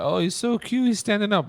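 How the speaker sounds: high, gushing imitation of the bartender lady. Dad retelling the bartender gushing over his baby, high and cooing. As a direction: coo it like a charmed stranger. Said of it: "the bartender lady was like".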